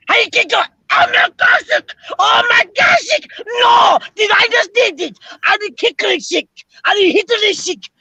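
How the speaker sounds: put-on excited German accent shouting no. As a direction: panicky comic German accent. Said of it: "you sound like Schultz from".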